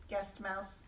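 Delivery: ordinary delivery, nothing marked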